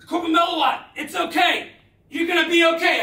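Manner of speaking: high, loud shout over the rotors; shouted reassurance. Retold or imitated. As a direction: yell it so he hears you over the noise. Shouting over helicopter noise.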